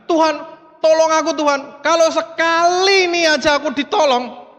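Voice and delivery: acted desperate prayer, pitched high; tearful pleading. Man fallen into a ravine praying to God, acted tearful pleading. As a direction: plead high, as if begging through tears. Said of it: "sambil nangis, dia bilang".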